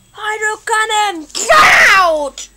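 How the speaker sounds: high shouted battle move; battle cry; attack call. Acted.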